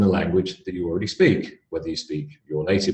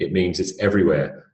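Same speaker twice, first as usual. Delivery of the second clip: ordinary delivery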